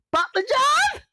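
sudden very high squeal; squeaky shout. Acted. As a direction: shriek the word out in a silly high voice. Calls out the dish. (ince sesle çığlık atarak)

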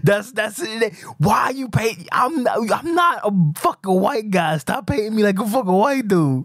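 high-pitched, exasperated complaint; indignant protest. Real, not acted. Boss objecting to the impression of him, high indignant protest. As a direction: push the voice up in comic outrage.

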